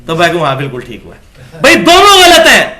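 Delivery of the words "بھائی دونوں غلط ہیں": emphatic protest. Real, not acted. Preacher rebuts the excuse.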